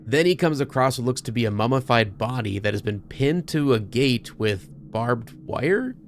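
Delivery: ordinary talk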